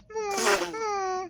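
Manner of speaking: high whining dog hum; dog whimper. Acted.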